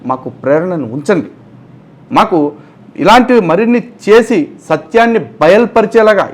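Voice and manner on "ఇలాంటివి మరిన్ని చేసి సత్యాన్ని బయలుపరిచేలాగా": loud, high mock preacher tone; mock pastor phrasing. Commentator mocking the pastor's churchy language, hammed up. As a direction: ham up the church jargon. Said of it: "ఇలా భాషలో చెప్పాలంటే".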